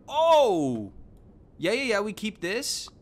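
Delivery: loud, high burst of surprise; excited surprise. Real, not acted. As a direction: exclaim as if suddenly spotting a find. Sudden find in the shop.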